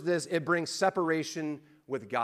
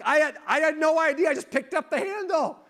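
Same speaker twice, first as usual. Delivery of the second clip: much higher and louder, protesting; hammed-up kid pleading innocence; whiny teen son; 13 semitones higher, 7 dB louder than usual. Son defends himself to dad.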